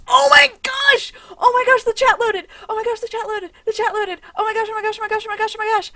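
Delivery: high, loud giddy squealing; gushing with joy that chat is back; excited squeals. Real, not acted. Streamer squealing at chat finally loading, excited. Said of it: "I'm so excited".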